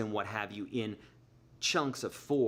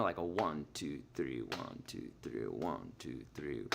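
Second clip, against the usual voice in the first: much lower, much softer one two three; quiet waltz count; 9 semitones lower, 7 dB quieter, slower than usual. Shown as a demonstration. Teacher demonstrating a waltz, counting softly. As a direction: drift into a hushed waltz count. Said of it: "A three would be a waltz".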